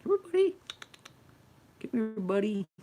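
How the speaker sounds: squeaky high coaxing to a pet; high pet-talk call. Real, not acted. Calling the cat over.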